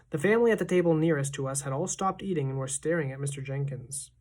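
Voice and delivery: ordinary delivery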